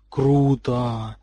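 low, drawn-out awe; hushed-style amazement without dropping volume; awed exhale. A narrator's reading. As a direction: breathe it out in wonder. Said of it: "выдохнул Рон вместе с другими ребятами".

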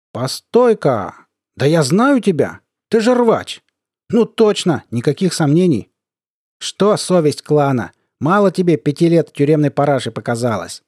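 high, drawn-out mocking recognition; needling colonel. Colonel recognizing a veteran, gloating.

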